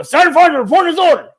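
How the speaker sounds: loud, high parade-ground report; loud military self-report; barked military report. Retold or imitated. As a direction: snap out the report at attention. Reporting in to captain.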